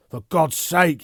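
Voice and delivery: loud high outburst; loud anguished outburst at the woman he loves; burst out. Acted. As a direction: explode at her. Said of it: "he burst out".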